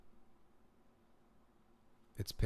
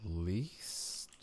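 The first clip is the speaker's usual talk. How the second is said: normal talking voice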